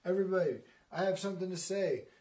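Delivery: emphatic announcing voice; Thor. Acted. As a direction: command everyone's attention as a giant angel. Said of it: "gigantic angel comes the angel Thor".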